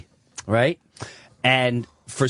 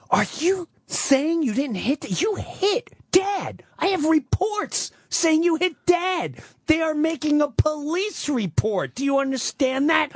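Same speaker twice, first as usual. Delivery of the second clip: much higher, agitated pleading; confronting mother; 13 semitones higher than usual. Retold or imitated. Son on the phone confronting his mother about the abuse, high and upset. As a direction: press her hard, voice climbing in disbelief.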